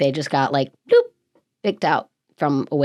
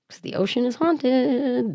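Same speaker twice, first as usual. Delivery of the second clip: mock spooky; 8 semitones higher, 3 dB quieter than usual. Spooky joke.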